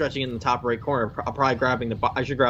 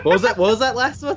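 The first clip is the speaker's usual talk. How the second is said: high, laughing question; 7 semitones higher, 4 dB louder than usual. Real, not acted. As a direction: giggle through the words.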